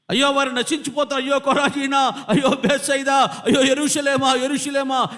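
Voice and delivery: loud, high cries of woe; Jesus's weeping lament. Acted. Preacher voicing Jesus weeping over Jerusalem, loud anguished lament. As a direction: wail in anguish over doomed cities. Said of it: "యేసు ప్రభు ఏడవడం గల కారణం".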